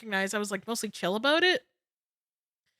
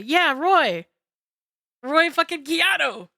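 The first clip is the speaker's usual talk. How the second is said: higher, louder hype for a name; excited cheer; 6 semitones higher, 5 dB louder, slower than usual. Real, not acted. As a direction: shout his name like a fan.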